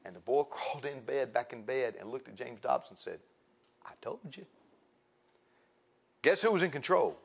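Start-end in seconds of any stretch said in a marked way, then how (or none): 3.8-4.5 very soft, higher-pitched I-told-you-so; tiny voice, quietly vindicated; smug hushed boy. Retold or imitated. Boy to James Dobson back in bed, hushed and smug.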